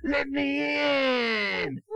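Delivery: loud growling demand at the door; wolf shout. Acted. Father reading to his child, shouting as the wolf at the door. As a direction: bang out the words like a big bad wolf.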